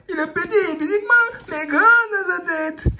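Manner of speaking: high playful character voice; squeaky gadget voice. Pretend. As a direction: play the little gadget, proud. High put-on voice for the device.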